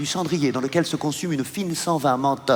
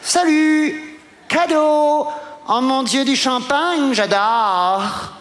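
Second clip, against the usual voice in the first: much higher, gushing society lady; posh lady voice; 7 semitones higher, much slower than usual. Acted. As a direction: squeal it like an excited rich woman. Voicing the posh woman Barbara.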